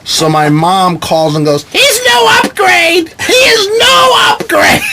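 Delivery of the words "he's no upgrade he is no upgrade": very high, slow mock-mom shriek; outraged mom. Mom calling about her son's magazine ad, mock-outraged shriek. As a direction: cry it out like a caricatured, indignant mother.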